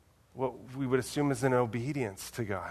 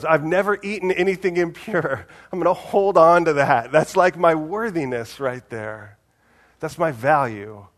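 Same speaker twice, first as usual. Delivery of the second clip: louder and higher, puffed-up Peter clinging to his record; proud insistence; 4 semitones higher, 7 dB louder than usual. Retold or imitated. Peter to God, proudly defending his worth, louder and higher. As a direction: boast like a man guarding his one clean spot.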